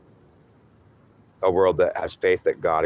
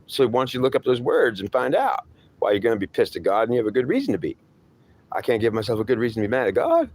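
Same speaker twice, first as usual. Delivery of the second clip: much higher, indignant refusal, quickening at the end; stubborn defiant voice; 7 semitones higher than usual. Acted. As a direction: dig in your heels, indignant. Stubborn voice refusing to look words up.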